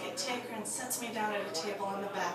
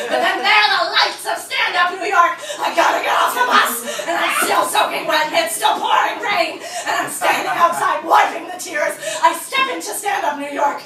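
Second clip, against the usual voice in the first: much louder and higher, tumbling out; comic despair racing through the rainy city; frantic, laughing retelling; 4 semitones higher, 10 dB louder than usual. Real, not acted. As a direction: pour it out in a rush.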